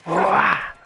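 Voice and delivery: short, high yell of alarm; startled yell. Real, not acted. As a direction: yelp as if suddenly jumped. Startled by wolf in game.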